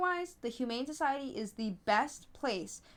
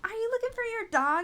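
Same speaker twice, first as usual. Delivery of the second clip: much higher, re-enacted friendly call; 11 semitones higher than usual. Driver asking a searching woman, high re-enacted call. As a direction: call across to a stranger, pitched high.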